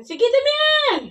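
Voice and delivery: high, loud and drawn-out surprised outcry at the pile of soap; surprised exclamation. Real, not acted. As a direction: cry out in surprise, stretching the words. Child pouring too much soap. (gulat na malakas na sigaw)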